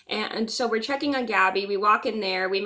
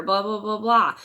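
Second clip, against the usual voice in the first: normal talking voice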